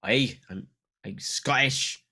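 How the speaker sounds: mock Scottish accent, slow. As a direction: ham up a Scottish brogue. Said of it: "read this question in your best Scottish accent".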